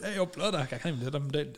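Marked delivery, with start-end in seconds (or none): 0.0-0.5 bad English street accent. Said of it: "That definitely wasn't it".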